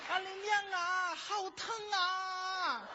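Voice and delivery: high, long-drawn wail of pain in Henan dialect; 河南话版施瓦辛格. Acted. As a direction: howl it in broad Henan dialect, stretching each ending. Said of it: "施瓦辛格". (河南话高声拖长喊疼)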